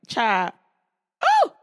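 high, loud "oh!" jumping way up; impressed exclamation. Retold or imitated. Spotting a fine guy at the resort, loud high gasp. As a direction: yelp like you just saw someone gorgeous. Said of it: "I was like".

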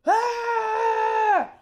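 high excited fan shriek; fangirl scream. Retold or imitated. Comic recalling meeting her idol, high thrilled shriek.

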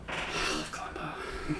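hushed, breathy whisper on a sigh; barely audible adoring whisper. Real, not acted. Fan sighing devotion to the show.

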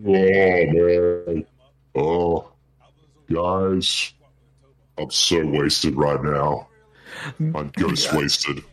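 put-on slow, low drunken drawl. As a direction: play it sloppy drunk.

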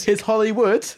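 high shouted laugh. Real, not acted. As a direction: shriek it while cracking up. Cracking up at line.

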